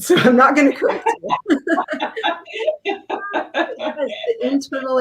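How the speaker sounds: high, fast laughing talk; giggling through the words. Real, not acted. Laughing at friend's pronunciation.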